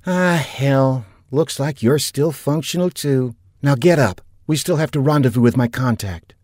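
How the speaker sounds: gruff but teasing, pushing him up; woman captain. A narrator's reading. Tough captain hurrying her hurt partner, lightly voiced woman.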